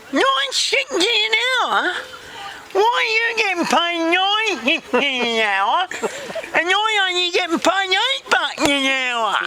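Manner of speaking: very high, slow, garbled outrage; indignant Vicky. Acted. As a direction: wail the complaint in a mangled put-on voice.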